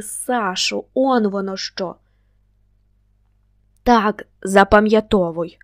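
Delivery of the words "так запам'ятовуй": loud, sharp command; forceful, raised-voice instruction; barked order. A narrator's reading. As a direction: bark it out so he listens. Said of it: "гаркнув йому".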